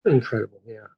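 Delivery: soft, low deadpan praise; drily understated agreement; mock underwhelmed enthusiasm. Real, not acted. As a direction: say it quietly, with no excitement at all. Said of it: "enthusiasm in your voice".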